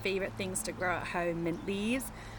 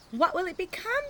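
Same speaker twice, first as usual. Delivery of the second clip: put-on higher, louder curious kid voice; Pete asking; 10 semitones higher, 5 dB louder than usual. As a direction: ask it wide-eyed like a small boy. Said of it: "Pete asks".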